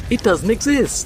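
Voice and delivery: spring the letdown on them gleefully; mock gotcha reveal. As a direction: seller pulling the rug out on a hyped buyer, taunting. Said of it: "turn around and go".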